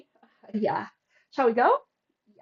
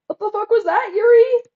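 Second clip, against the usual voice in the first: much higher and louder, ending in a rising yelp on the name; rising disbelieving shout; 11 semitones higher, 10 dB louder than usual. Real, not acted. Streamer yelling again at Yuri, pitch climbing into a shriek. Repeats the outburst, even bigger.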